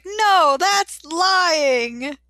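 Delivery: high, loud, hammed-up outcry; mock indignant protest. Host voicing Ashley objecting to a lie, mock indignant. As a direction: wail the objection dramatically.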